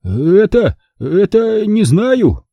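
high-pitched, halting bewilderment; flustered. A narrator's reading. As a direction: stumble over a stunned reply. Stunned, stammering answer.

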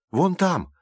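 high, urgent cry of discovery; sudden exclamation. A narrator's reading. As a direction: call out a sudden sighting. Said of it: "воскликнула вдруг Калипсо". (внезапный возглас)

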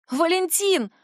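high shout of a name. A narrator's reading. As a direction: call out in panic. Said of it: "ору я".

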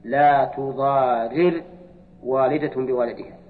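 لا تضارر slow, two separate r's with i, tuḍārir; unmerged active reading. Shown as a demonstration. Teacher showing the active reading, the double r split apart. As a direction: spell out لا تضارر slowly, ra then ri. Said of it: "صار فك الإدغام هكذا".

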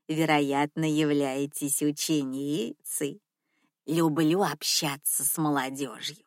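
low, soft purring grandma voice; purring old lady. Acted. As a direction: purr the reproach gently. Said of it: "промурлыкала старушка".

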